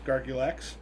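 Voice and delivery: ordinary talk, nothing marked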